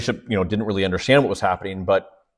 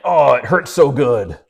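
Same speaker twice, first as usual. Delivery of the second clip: mock gym guy; 2 semitones higher, 4 dB louder, slower than usual. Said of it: "gym guys ... they're like".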